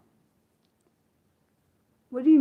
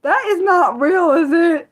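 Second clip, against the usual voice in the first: high, slower disbelieving question; incredulous; 9 semitones higher, 4 dB louder than usual. Real, not acted. Streamer asking chat if the name is real, incredulous. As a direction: beg it not to be true.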